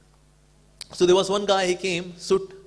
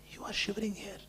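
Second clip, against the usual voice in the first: whisper; 4 semitones higher, 11 dB quieter than usual. Retold or imitated. Lady whispering to shivering stranger.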